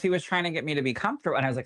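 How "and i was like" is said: normal talking voice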